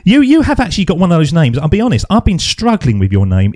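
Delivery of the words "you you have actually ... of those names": usual speaking voice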